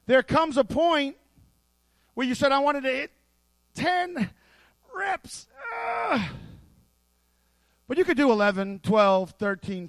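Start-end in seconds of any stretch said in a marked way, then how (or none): 2.5-5.5 straining rep count. Pretend. Lifter straining to finish his set, pushing higher. 5.7-6.3 high mock grunt of effort; strained grunt. Lifter finishing a heavy rep, high strained grunt.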